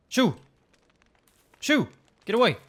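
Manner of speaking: loud sharp shoo; shooing an animal. Real, not acted. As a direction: shout them away. Chasing off monster eyes.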